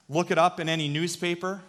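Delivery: ordinary delivery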